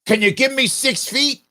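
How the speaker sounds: high mock yell at a stranger; angry man in the park yelling, hammed-up; retold yell. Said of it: "He yells at me".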